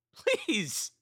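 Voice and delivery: high, loud sobbing plea; sobbing shout. A narrator's reading. Katie sobbing at her brother behind the glass, shouting. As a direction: shout through sobs. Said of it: "Katie shouted, sobbing".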